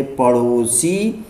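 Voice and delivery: पड़ोसी slowly, with flicked ṛ; careful flap model. Shown as a demonstration. Teacher recapping the lesson, पड़ोसी slowly with flapped ṛ. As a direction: say पड़ोसी as paṛosi, unhurried. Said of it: "इसे पढ़ेंगे".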